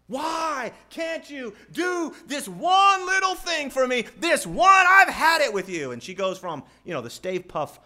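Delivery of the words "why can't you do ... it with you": high, furious, drawn-out shout; exploding frustrated mommy. Acted. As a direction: scream at the child like a mother at breaking point. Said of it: "mommy explodes. She's frustrated".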